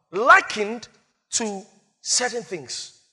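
ordinary talk

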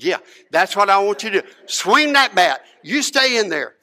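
emphatic, punched-out coaching orders; urging on. Retold or imitated.

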